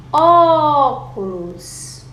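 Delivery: "óculos" called loud and high, first syllable held long; calling out the word. Shown as a demonstration. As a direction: stretch the Ó of "óculos" right out. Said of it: "A sílaba que eu mais demorei".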